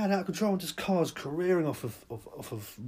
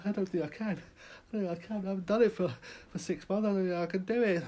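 nervous refusal; 6 semitones higher, faster than usual. Acted. Nervous diner afraid to sit.

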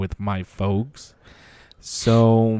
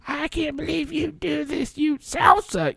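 high-pitched scolding shout; mom yelling. Retold or imitated. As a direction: yell at him, way higher. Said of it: "My mom's in the back yelling at my dad".

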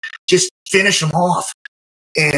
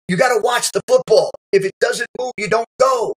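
coach instructing; 7 semitones higher than usual. Retold or imitated. Coach instructs the eager kid.

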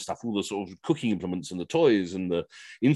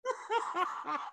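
high laugh; 11 dB quieter than usual. Real, not acted. Host laughs at unmuted mishap.

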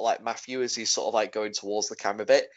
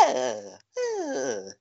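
mocking grunt; 5 dB quieter than usual. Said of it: "look as if you should work there".

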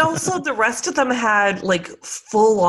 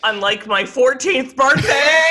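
shout; 5 semitones higher, 6 dB louder than usual. Real, not acted. Joking outburst at the host.

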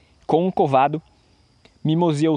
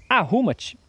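brief higher-pitched command; 6 semitones higher, slower than usual. A narrator's reading. Young man ordering his table to set itself, commanding. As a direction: give the magic order brightly raised.

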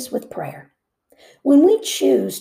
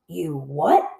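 ordinary talk, nothing marked

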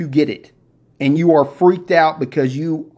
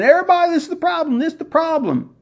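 high-pitched mock alarm, much higher; mock panic; 12 semitones higher, faster than usual. Host mimicking people who spread problems, high mock panic. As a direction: squeal it like a frantic doomsayer.

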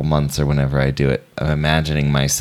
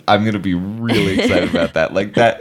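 laughing; 3 semitones higher than usual. Real, not acted. Giggling about painting mugs.

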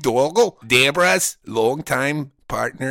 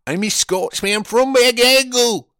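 higher, louder hammed-up Scottish accent; mock Scottish accent; 9 semitones higher, 4 dB louder than usual. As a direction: play a fake Scotsman insisting he's Scottish. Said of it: "I could do that accent".

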